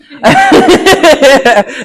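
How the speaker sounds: loud, high laughing fit; burst of laughter. Real, not acted. Medium to audience after misreading thirty-two, loud real laughter. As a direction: break into a big, real laugh.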